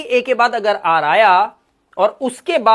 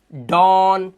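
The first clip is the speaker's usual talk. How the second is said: normal talking voice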